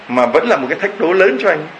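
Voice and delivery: ordinary talk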